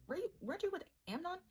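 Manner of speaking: sudden high suspicious question; Absalom asking. Retold or imitated. Absalom questions his sister about Amnon, high and suspicious. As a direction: pitch it way up, like a brother who suspects. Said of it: "and Absalom was like".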